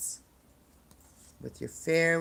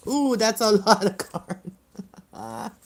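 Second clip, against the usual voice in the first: laughing surprise; 6 semitones higher, 5 dB louder, much slower than usual. Real, not acted. Sees many cards come out.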